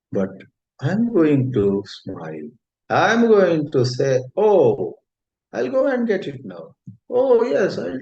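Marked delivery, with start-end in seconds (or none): 4.4-8.0 much higher, bright eager reply; cheerful. Pretend. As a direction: greet the family with sudden cheer. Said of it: "I'm going to be in good spirits, cheerful".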